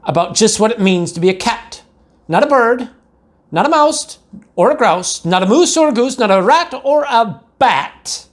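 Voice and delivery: high, singsong mother's rhyming scold; mother lecturing. A narrator's reading. Mother lecturing her kitten in a high singsong list. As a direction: rattle off the list like a fed-up mama.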